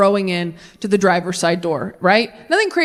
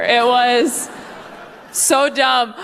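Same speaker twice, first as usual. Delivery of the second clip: normal talking voice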